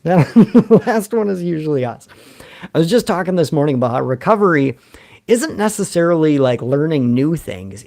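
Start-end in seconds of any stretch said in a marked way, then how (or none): 0.0-2.1 repeated through a laugh, louder. Real, not acted. Comedian laughing it out to his viewers. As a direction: laugh it out as you say it again.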